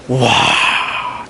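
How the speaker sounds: breathy, hushed wow of amazement; awed wow. Retold or imitated. Preacher recalling a majestic Ganges ceremony, awed. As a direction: let out a long, voiceless wow.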